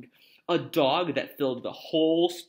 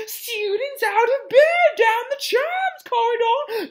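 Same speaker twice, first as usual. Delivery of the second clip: piercingly high, stretched-out alarm shout; bellowed; 11 dB louder, slower than usual. Acted. Peeves giving away the children's location, high bellow. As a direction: bellow it down the whole castle. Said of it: "Peeves bellowed".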